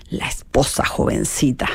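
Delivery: breathy, hushed little jab. Acted. Marchelina taunting her rival in a hushed jab. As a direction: slip the insult in under the breath. Mocking sung duel quotes.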